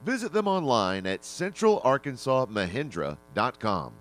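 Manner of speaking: upbeat radio commercial voice; ad read. Acted. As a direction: sell it like a local ad. Recorded style sponsor spot.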